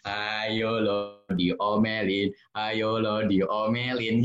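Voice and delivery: slow sing-song teasing; mocking taunt. Child mocking crying older sibling, slow sing-song taunt. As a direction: drag it out to tease the sad sibling. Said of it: "jangan sampai kalian bilang".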